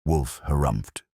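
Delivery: plain reading voice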